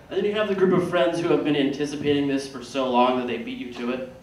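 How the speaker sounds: ordinary talk, nothing marked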